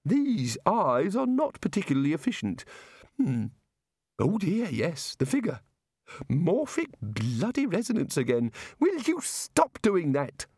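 high, airy voice of a muddled god; a dreamy, absent-minded deity explaining creation; vague god voice. A narrator's reading. Narrator voices the god explaining evolution to wizards, high and airy.

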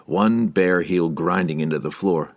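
usual speaking voice

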